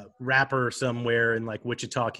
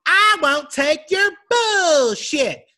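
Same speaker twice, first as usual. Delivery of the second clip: Ruth impression; 5 dB louder, slower than usual. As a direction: sneer it slowly like Ruth from Ozark. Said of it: "What does she sound like?".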